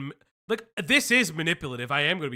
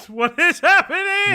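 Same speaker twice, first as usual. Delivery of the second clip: shocked shriek; 13 semitones higher, 11 dB louder than usual. Real, not acted. Shocked by chat message.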